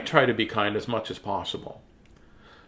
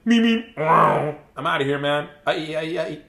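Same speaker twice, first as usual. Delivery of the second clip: higher, hammed-up teenager voice; mock teenager; 3 semitones higher, slower than usual. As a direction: play a silly teen making an exit. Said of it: "the teenager's like".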